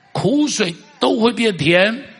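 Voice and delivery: preacher lifting the hall with a slow cry; ringing proclamation. Real, not acted. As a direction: rise high and loud, slowing on each word.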